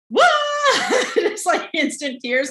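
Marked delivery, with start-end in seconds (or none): 0.1-1.1 high, loud whoa breaking into a laugh; retold overwhelmed whoa. Shouted laughing whoa as she recalls bawling in the meeting. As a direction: replay the moment it all hit, with a shouted laughing whoa. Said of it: "And I was like".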